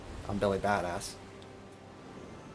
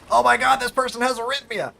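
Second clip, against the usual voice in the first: much higher and louder, mock alarm; hammed-up frantic EKG machine; panicking machine voice; 13 semitones higher, 8 dB louder than usual. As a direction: play the monitor shrieking in mock panic. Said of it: "it goes".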